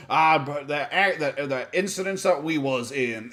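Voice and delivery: a slow, lightly put-on southern accent; a loose parody of a southern accent; mock southern accent. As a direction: drag it out in a hint of a southern drawl. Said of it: "he will just put on, like, a southern accent".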